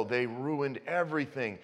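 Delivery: ordinary talk, nothing marked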